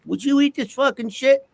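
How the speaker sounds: high-pitched mock indignation; indignant. Patient confronting the dietitian over bad food, indignant.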